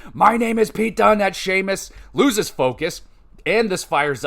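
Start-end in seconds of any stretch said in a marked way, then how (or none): 0.1-1.2 higher, louder mock yell of his own name; retold yell. Wrestler yelling his name at his opponent, mock yell. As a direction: shout it out like a wrestler hyping himself. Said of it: "starts yelling".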